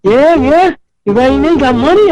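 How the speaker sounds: mocking challenge. Gang shaking him down.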